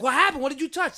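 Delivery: high, loud alarmed question; imitated alarmed mother. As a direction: cry out as a startled mama. Mother reacting to bleeding child.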